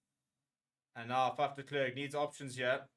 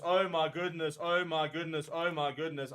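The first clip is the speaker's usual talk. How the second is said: usual speaking voice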